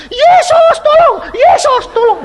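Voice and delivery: high, loud repeated shrieks; frantic nonstop cry for help; screamed like a mantra. Acted. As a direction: scream it over and over like a chant. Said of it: "kayak mantra saja".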